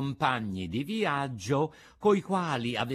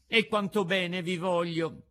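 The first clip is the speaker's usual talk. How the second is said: higher, lightly voiced little puppet; affectionate puppet; 6 semitones higher, 3 dB louder than usual. A narrator's reading. Puppet tells the fairy his love, affectionate.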